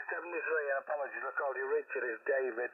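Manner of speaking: ordinary talk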